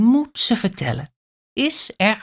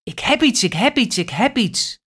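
excited; 4 semitones higher, faster than usual. A narrator's reading. Excited monkey repeating news.

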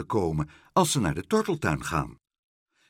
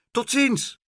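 high, loud farewell call; 5 dB louder than usual. A narrator's reading. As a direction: call goodbye across to the shore. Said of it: "riep Pluk".

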